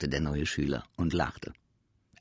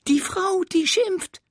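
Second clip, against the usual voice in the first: innocent creature; 8 dB louder than usual. Acted. Sams answers innocently.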